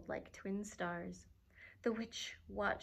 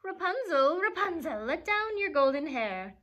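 loud, high call up the tower; 6 semitones higher, 10 dB louder than usual. Acted. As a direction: call it up to the window. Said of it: "call out".

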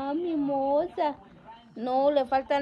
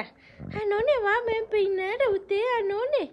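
mock outrage; 7 semitones higher, 4 dB louder than usual. Teasing outrage over hair.

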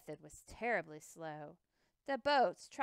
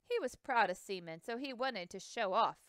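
usual speaking voice

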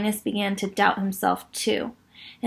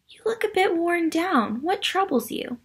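higher creature voice; 8 semitones higher than usual. Acted. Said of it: "the thing said".

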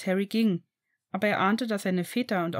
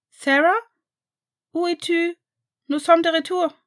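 high, loud call from the hallway; 9 semitones higher, 8 dB louder than usual. A narrator's reading. Sam arriving home, calling for Sarah, loud and high. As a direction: call out to find her. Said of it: "hörten sie Sams Stimme rufen".

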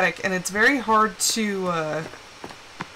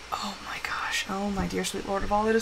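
breathless whispered disbelief; stunned whisper; 5 semitones lower, 9 dB quieter than usual. Real, not acted. Chainsaw fails, fast shocked whisper.

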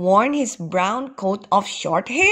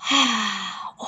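long sigh of relief; deep breath out. A narrator's reading. Eddie hears a lantern was brought, relieved exhale. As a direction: let out a long, audible breath. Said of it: "let out a deep breath".

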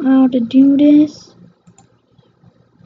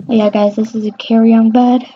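ordinary delivery

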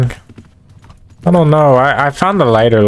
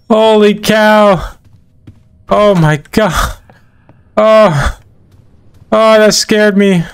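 terrified; 9 semitones higher, slower than usual. Real, not acted. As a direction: shout in real fright. Reacting to jump scare.

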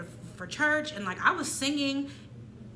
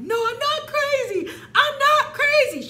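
much higher and louder, slow indignant denial with a laugh; indignant refusal; 8 dB louder, slower than usual. Retold or imitated. Refusing mom's offer of therapy, indignant and high. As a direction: protest hard, drawn out.

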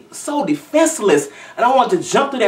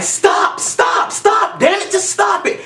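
shouted stops, much higher at the end; retold yelling; 14 semitones higher, slower than usual. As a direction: yell at the screen to make them stop. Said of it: "I'm screaming at the computer screen".

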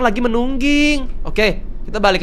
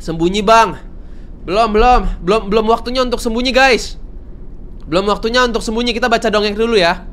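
ordinary delivery

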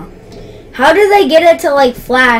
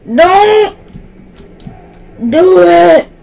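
higher, louder plea to stop; 5 semitones higher, 5 dB louder, slower than usual. Real, not acted. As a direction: cry out for them to stop. Pleading with teammates in game.

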